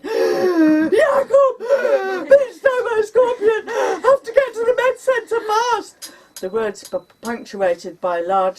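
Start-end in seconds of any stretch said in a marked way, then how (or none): 0.0-6.1 high, loud, staccato between gasps; gasping sobbing screams. Retold or imitated. Stung woman begging neighbour for a fast ride, gasping screams. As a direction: scream in bursts, out of breath with panic. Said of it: "my words staccato, big gasping breaths".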